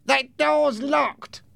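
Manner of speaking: high, slow character voice; odd high-pitched henchman; another gang member. Acted. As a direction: report it slowly in a strained high voice. Second gang member reports.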